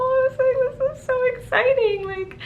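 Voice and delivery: high, squeaky overjoyed gush; tearful excitement. Real, not acted. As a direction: gush through happy tears. Her own excited reaction, tearful.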